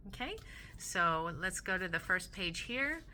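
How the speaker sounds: ordinary delivery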